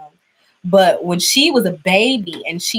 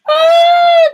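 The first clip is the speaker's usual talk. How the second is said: sudden scared shriek; a startled high scream of fright; startled yelp; real scream; 10 dB louder than usual. Real, not acted. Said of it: "every single time that I get scared, I scream".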